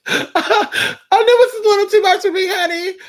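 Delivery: very high, squeaky comic wail; overwhelmed squeal. Pretend. Reader joking it's too much, high squeaky wail. Same aside repeated, squealed.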